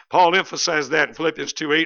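usual speaking voice

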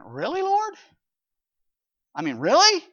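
high, disbelieving "Really, Lord?"; mock doubt thrown up at the Lord; incredulous. Pastor voicing Martha doubting the Lord, incredulous. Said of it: "It's almost like he's saying".

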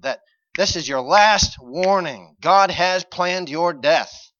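loud, high urgent warning, key words drawn out; preaching at full force. Real, not acted. Preacher warning as if to Ahab, impassioned and rising. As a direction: build into a forceful pulpit plea to repent.

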